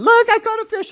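very high, loud squeaky voice; wife showing off a tiny fish; mock excited wife. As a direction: play his wife thrilled with her catch. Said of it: "She said".

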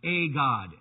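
"a" as a full "ay", set apart from God; stress on "a". Shown as a demonstration. Preacher naming the disputed article, stress on "a". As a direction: lean on the article "a". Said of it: "the emphasis is on a God".

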